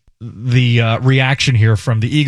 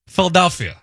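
higher-pitched local "Philadelphia"; Philly accent demo; 5 semitones higher than usual. Shown as a demonstration. Host trying the city's name in a Philly accent. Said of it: "that's how you do it".

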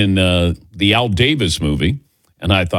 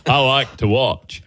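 slow, laughing half-hearted Forrest Gump voice; 2 semitones higher than usual. Retold or imitated. Host trips into a lightly done Forrest Gump impression. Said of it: "What am I, Forrest Gump here".

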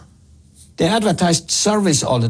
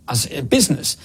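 normal talking voice

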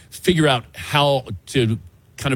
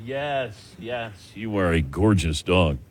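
softer, slow, lower praise for a good dog; tender dog praise; 5 semitones lower, 6 dB quieter, slower than usual. Real, not acted. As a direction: coo gently to the dog.

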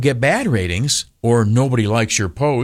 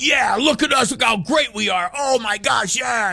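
much higher, gloating whoop; mock celebration; 11 semitones higher than usual. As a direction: ham up the self-congratulation. People celebrating good ratings.

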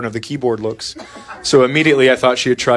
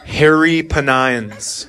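slow deadpan reading; much slower than usual. Acted. Dad reads misspelled search slowly.

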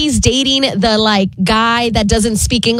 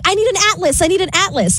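higher, lightly voiced Phoebe; quoting Phoebe; 7 semitones higher, faster than usual. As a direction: play it a bit higher, ditzy. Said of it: "then she's like".

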